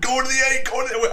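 high, loud excited shouts with a laugh; excited yelling. Retold or imitated. Friends on shore yelling at the angler, loud and laughing. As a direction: yell it from the shoreline, all worked up. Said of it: "we're just screaming at him".

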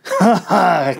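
loud, high mocking "ha ha"; mocking laugh. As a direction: laugh at the captive's claim. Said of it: "Captain Drossik laughed".

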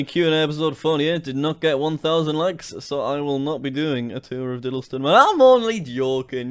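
usual speaking voice

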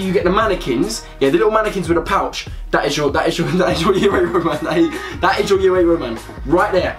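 ordinary delivery

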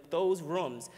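usual speaking voice

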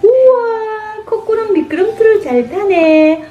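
high, slow "우와" of praise; delighted praise. Acted. Mom gushing over Coco on the slide. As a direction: gush over the other child.

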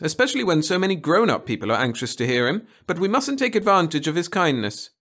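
high, loud teacher voice; schoolteacher to class. Acted. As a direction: call the class together on a trip.

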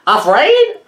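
high, loud disbelieving squawk; incredulous parrot. Acted. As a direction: echo it in shock like a parrot. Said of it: "clucked Papagayo".

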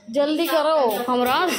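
loud, high-pitched call to hurry. Real, not acted. Vlogger tells Hamraaz to hurry, calling out loudly.

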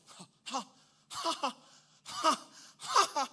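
slow, spaced-out staged laugh; deliberate, separated chuckles; God's mocking laugh. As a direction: build from soft to fuller "ha"s. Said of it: "God just laughing".

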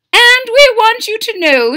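high, loud, announcing; grand royal invitation. Acted. King and queen call out their invitation to the boy. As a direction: proclaim it like royalty.

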